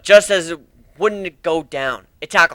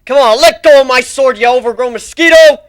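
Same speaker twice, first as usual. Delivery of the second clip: loud, high furious yell; angry yell; 10 semitones higher, 13 dB louder than usual. Acted. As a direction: shout at the beast holding the sword. Yells at monster in fight.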